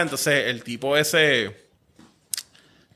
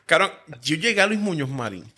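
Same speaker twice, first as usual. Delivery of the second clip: ordinary delivery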